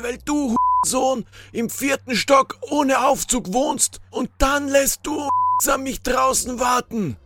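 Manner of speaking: high, strained, irate character voice; ranting stranger. A narrator's reading. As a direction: rant indignantly in a high voice. Angry stranger rants at Poldi.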